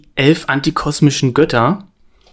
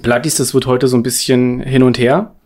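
ordinary talk

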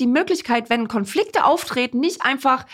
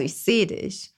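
soft and tender; 4 semitones lower, 5 dB quieter, slower than usual. Shown as a demonstration. Soft example phrase.

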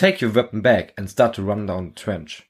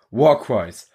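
ordinary delivery, nothing marked

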